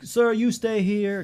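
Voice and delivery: put-on slow, high Italian-accented clerk; mock Italian accent. Airport lady directing a passenger, mock Italian accent. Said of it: "No, it's Italian".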